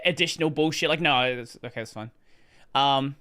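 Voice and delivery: normal talking voice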